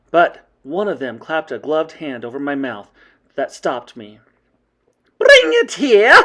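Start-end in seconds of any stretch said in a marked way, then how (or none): 5.2-6.2 slow, very high, loud shriek; shouted witch command. A narrator's reading. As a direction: shout it imperiously, stretching the words. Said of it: "shouted the Grand High Witch".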